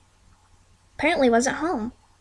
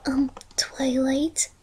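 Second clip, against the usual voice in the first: slow, breathy whisper; frightened hushed call; timid whisper; 8 dB quieter, much slower than usual. A narrator's reading. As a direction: whisper it, scared to speak up. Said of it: "Fluttershy whispered".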